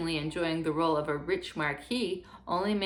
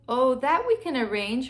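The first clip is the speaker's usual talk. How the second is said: higher, louder bright burst of eagerness; eager exclamation; 7 semitones higher, 6 dB louder than usual. A narrator's reading. Cat eagerly promising the princess, higher and louder. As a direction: jump in eagerly with a raised voice. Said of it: "exclaimed the cat".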